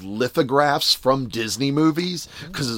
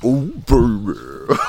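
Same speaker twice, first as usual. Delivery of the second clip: slow, breathy imitation of neighbor's moan; lightly voiced overheard moan; slow mock moan heard through the wall; slower than usual.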